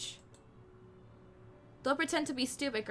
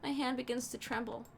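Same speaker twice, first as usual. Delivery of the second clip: ordinary talk, nothing marked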